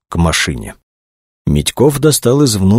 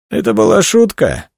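snide. A narrator's reading. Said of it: "ехидно произнёс Митьков".

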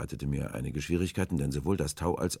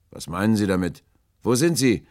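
loud, raised challenge; calling out; 6 semitones higher, 11 dB louder than usual. A narrator's reading. Sailor challenges the hidden stranger, calling out loudly. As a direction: demand it loudly across the water.

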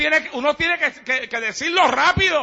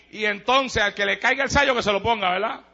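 usual speaking voice

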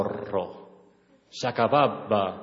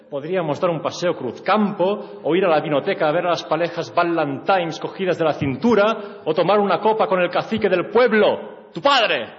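building pleading; 7 semitones higher, 6 dB louder than usual. Acted.

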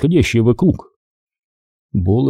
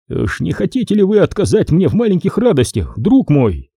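higher-pitched, lightly acted wheedling question; lightly voiced major; 6 semitones higher than usual. Major asking Nick to indulge him, lightly voiced. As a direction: put a higher lilt on the major's request.

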